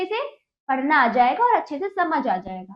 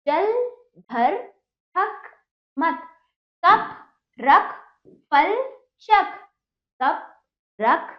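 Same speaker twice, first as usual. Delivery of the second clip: each word stands alone with a pause; measured dictation for beginners; word-by-word reading; 3 semitones higher, 3 dB louder, much slower than usual. Shown as a demonstration. (एक-एक शब्द रुककर पढ़ाना)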